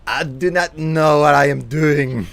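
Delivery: Dexter voice. Acted. Frustrated Dexter-style outburst.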